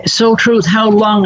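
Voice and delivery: calls out to get the viewer's attention; calling out. Real, not acted.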